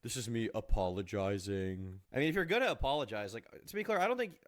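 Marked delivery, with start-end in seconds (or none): 0.0-2.0 slow, mock-smug apology. Streamer mimics a YouTuber's apology, mock smug. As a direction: imitate a drawn-out, self-satisfied apology. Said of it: "he says it so smugly".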